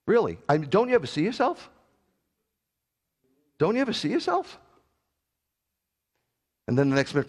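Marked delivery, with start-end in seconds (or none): 0.7-4.6 high mocking rebuke. Real, not acted.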